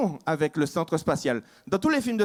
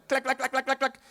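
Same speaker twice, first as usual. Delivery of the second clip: bruitage de câble; 7 semitones higher than usual. Acted.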